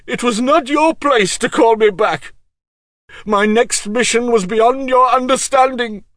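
loud, high, strained reproach; stern rebuke. Acted. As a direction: scold him for the summons. Macross rebukes Pug.

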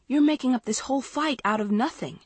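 high-pitched exasperation; frustrated cry. A narrator's reading. Maggie protests a fight made from nothing, frustrated. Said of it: "Maggie cried in frustration".

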